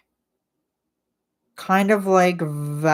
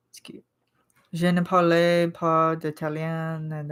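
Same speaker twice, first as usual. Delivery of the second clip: normal talking voice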